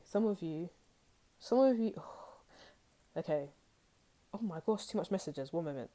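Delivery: ordinary talk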